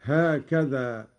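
ordinary talk

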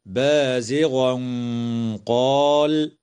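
بازغا قال with the tanween hidden into a heavy nasal before the ق; slow careful بازغا قال; hidden nun with ghunna. Shown as a demonstration. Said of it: "حكمه الإخفاء مع الغنة مفخمة".

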